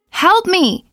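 high, loud desperate scream for help. Acted. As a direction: shriek it like she's lost in the dark. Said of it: "a woman screamed".

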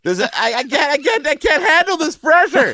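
high, loud panicked wail; overwhelmed by pressure, rising cry; mock panic. Mock panic over being wrong.

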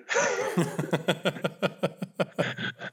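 long real laugh; laughing softly. Real, not acted. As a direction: laugh at your own prank. Laughing at own joke.

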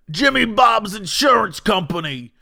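radio pitchman. Retold or imitated. Listener mocking a radio insurance ad, shouted announcer voice. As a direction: belt out the company name like an ad. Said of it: "guy who's on the radio".

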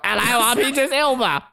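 high-pitched comic impression; Idris Elba. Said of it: "gonna work on impressions".

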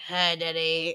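a hint of eerie baby; low creepy greeting. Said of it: "the baby's like".

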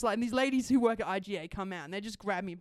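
ordinary delivery

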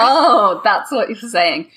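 mock dawning comprehension; sudden realization. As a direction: act out finally getting it, laughing at the end. Mimics listeners finally understanding.

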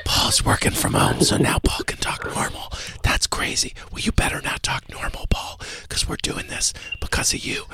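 hushed whispering host voice. Acted. Host whispering to his co-host on the Whisper Show. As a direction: keep the whole show in a whisper.

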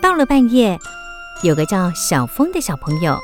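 usual speaking voice

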